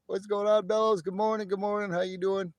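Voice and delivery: high, upbeat hello; excited greeting. Real, not acted. Streamer welcoming arriving viewers, high excited greeting.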